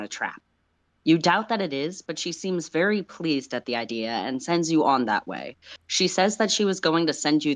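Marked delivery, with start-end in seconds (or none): none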